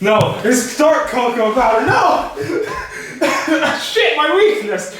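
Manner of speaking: high, loud mock anguish; hammed-up despair, high and loud. Mock dismay at dark cocoa.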